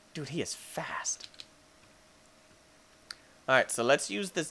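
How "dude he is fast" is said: slow hushed whisper; tense whisper. Real, not acted. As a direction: whisper so it can't hear. Hiding from predator.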